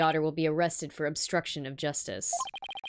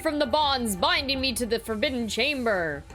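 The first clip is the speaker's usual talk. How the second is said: grandiose demon king; 7 semitones higher than usual. Acted. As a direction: announce yourself as an evil demon lord. Voices possessing demon king.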